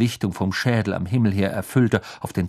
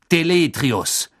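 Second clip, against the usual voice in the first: slow, louder spell call; 7 semitones higher, 5 dB louder, slower than usual. A narrator's reading. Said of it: "rief Mister Diggory".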